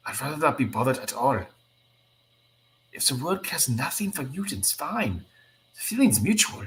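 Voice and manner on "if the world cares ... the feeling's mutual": bitter outburst. Acted. Nightcrawler rejects the X-Men.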